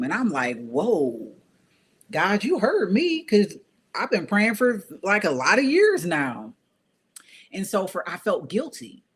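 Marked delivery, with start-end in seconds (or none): 0.7-6.5 high, delighted burst at God; higher, louder happy disbelief; excited. Retold or imitated. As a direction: exclaim like a prayer came true. Excited prayer answered.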